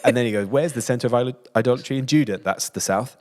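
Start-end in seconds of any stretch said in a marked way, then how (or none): 0.5-2.4 loud, raised challenge; challenging voice. Retold or imitated. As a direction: call out the question.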